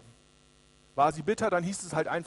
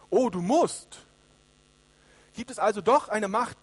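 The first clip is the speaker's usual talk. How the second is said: loud, incredulous fool's question; mock astonishment; 2 semitones higher, 6 dB louder than usual. Fool questioning the mighty king, loudly astonished. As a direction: challenge it loudly, as if amazed.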